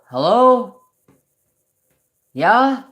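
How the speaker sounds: high, loud calling out to someone unseen; dad talking to his late wife; dad answering mom. Retold or imitated. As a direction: call out like answering a voice nobody else hears. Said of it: "all of a sudden he'll go".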